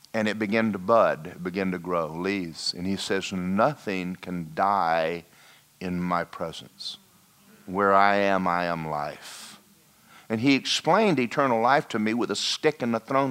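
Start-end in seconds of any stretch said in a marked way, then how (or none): 3.3-9.6 slow solemn voice of God. Retold or imitated. God speaks in the throne room.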